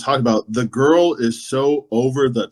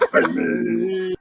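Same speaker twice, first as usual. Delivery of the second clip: villain threat; 6 semitones higher, slower than usual. Retold or imitated. Villain voiced in retelling.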